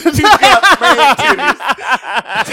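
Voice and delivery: high, loud, slow laughing cry; laughing exclaim. Real, not acted. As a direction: crack up while insisting. Laughing outburst.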